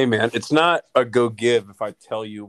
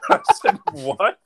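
laughing through the retelling; real laughter breaking into the words; 5 dB louder than usual. Real, not acted.

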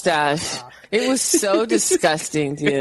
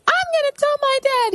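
mock little girl. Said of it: "she's like".

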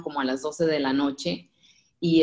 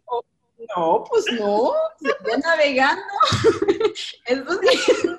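high, loud, laughing reply; laughing answer; 14 semitones higher, 4 dB louder than usual. Real, not acted. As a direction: answer while laughing at yourself. (respuesta riendo, aguda y fuerte)

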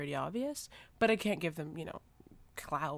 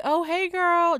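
fake friendly; 12 semitones higher, 11 dB louder, much slower than usual. Politely greeting a stranger to stay safe.

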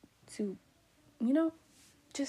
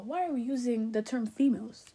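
ordinary talk

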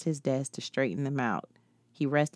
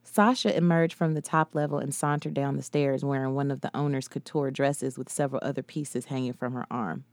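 plain reading voice

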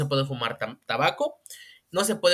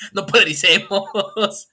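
slow, breaking into laughter; laughing through it; 10 semitones higher, 4 dB louder, slower than usual. Real, not acted. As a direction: say it while cracking up. Repeat while laughing, slow.

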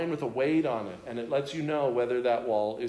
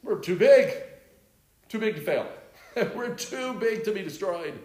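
higher, louder mocking brag; mock boast; 6 semitones higher, 4 dB louder than usual. Preacher mocks the leaders' arrogant boast, higher and louder. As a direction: ham up their arrogance.

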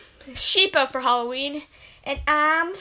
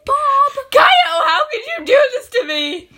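high, loud sobbing shout of betrayal; wailing cry; 13 semitones higher, 10 dB louder than usual. Acted. A parent wailing at the child for betrayal, crying shout. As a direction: wail it out like a wronged parent.

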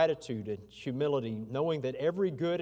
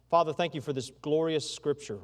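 ordinary talk

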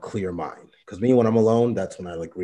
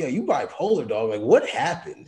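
high-pitched mock bafflement, tipping into a laugh; amused disbelief; 4 semitones higher than usual. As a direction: act out being baffled, pitch jumping up. Retells baffled reply, ends laughing.

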